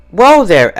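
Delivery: high, loud whoa; cautioning. A narrator's reading. Twilight calms Applejack, high and loud. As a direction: rein her in. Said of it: "Twilight Sparkle cautioned".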